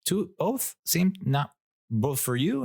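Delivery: confused server. Retold or imitated. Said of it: "she was like".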